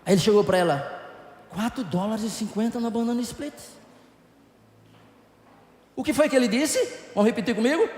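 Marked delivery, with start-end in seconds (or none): 1.5-3.5 matter-of-fact husband. Retold or imitated. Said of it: "ele falou intelectualês".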